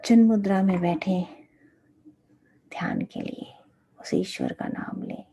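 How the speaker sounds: soft, low meditation guidance; guided meditation. Real, not acted. Teacher seating disciples for meditation on God's name, soft guided meditation. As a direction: settle them into meditation with long pauses.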